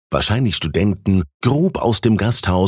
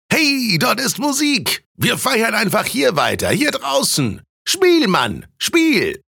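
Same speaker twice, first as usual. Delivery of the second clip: high, excited calling out; rowdy young reveller; slower than usual. A narrator's reading. Tipsy student urging Thies to play, boisterous high call. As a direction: egg the musician on in a high voice.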